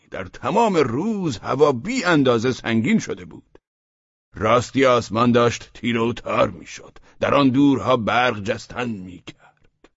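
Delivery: high-voiced figure in a read-aloud story; story character speaking. A narrator's reading. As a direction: pitch it high and stretch the opening word.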